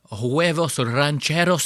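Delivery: overdone Spanish pronunciation; mock Spanish accent. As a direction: imitate a diner putting on a Spanish accent. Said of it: "try to say it like a".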